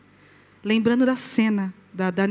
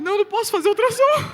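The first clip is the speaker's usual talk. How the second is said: flustered refusal; 9 semitones higher, 3 dB louder than usual. Retold or imitated. Refusing ultrasound in shock.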